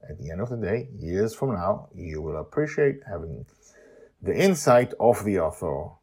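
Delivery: ordinary delivery, nothing marked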